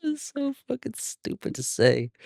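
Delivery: high, slow words squeezed through laughter; real laughing. Real, not acted. Narrator laughing at the silly line he just read. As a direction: crack up while talking.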